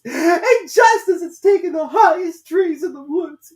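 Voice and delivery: very high, loud, slow and tearful bird voice; distressed pigeon. A narrator's reading. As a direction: wail like a harried mother bird. Pigeon goes on complaining.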